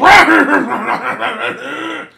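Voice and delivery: big high laugh; real loud laughter. Real, not acted. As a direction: burst out laughing. Laughing after the voice.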